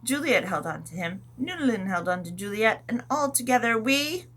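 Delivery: ordinary delivery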